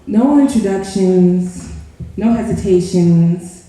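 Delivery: bold, rhythmic poetry delivery; spoken word poem. Acted. Poet to a crowd, bold spoken word delivery. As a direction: slam poet opening her piece boldly.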